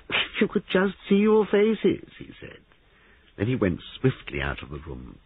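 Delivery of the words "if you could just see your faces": very high, drawn-out teasing; laughing mockery. A narrator's reading. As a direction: crow at their shock, high and slow. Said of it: "laughed again".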